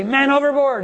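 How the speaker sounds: mock scream. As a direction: call the alarm high, half-shouted. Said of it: "and you start screaming".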